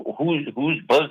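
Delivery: usual speaking voice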